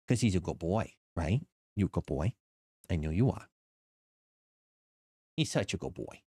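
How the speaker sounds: dog voice. Real, not acted. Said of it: "This is my dog voice".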